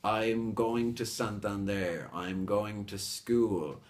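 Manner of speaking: slow model lines, SANTANDER and SCHOOL begun straight on S; no "eh" before Santander or school, slowly; measured demo with SCHOOL as one beat; clean initial S. Shown as a demonstration. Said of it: "you have to say".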